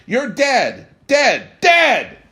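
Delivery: high, loud yelling that builds with each word; rising child's yell. A narrator's reading. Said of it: "kept snapping his pistol and saying".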